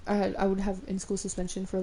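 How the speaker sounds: ordinary delivery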